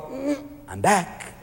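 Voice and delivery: quiet, slow, knowing "I'm back"; soft sly return. Pretend. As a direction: purr it out softly. Nature voiced returning.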